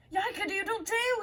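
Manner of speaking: high crowing cry; high startled cock-a-doodle; rooster cry. A narrator's reading. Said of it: "he cried".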